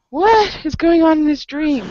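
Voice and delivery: high, startled disbelief; retold mid-dream alarm, pitched high; bewildered inner thought. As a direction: ask yourself what on earth is happening.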